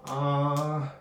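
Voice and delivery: open-mouthed aah for the exam; tongue held down saying aah. Pretend. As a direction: say aah like a patient at the doctor. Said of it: "can you say "ah" for me".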